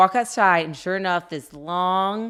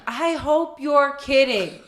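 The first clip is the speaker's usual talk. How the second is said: retold yell; 6 semitones higher, 3 dB louder, much slower than usual. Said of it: "and I scream down".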